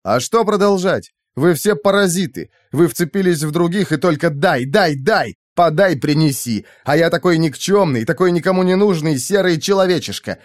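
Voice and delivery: bitter rant, pitched high; worked-up, high-pitched outburst; exasperated tirade. A narrator's reading. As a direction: let it all out, fed up. Fed-up narrator's tirade at captors.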